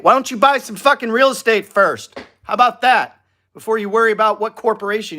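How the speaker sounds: exasperated rant. Real, not acted. Mocking viewers who ask about an LLC, fed-up outburst. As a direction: blast the question at them in disbelief.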